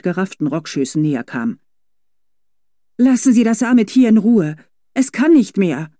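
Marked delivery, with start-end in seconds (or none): 3.0-5.9 higher, louder insistent plea; pleading demand. A narrator's reading. Felicitas demanding the driver leave the horse, loud plea. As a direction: stand firm for the poor animal.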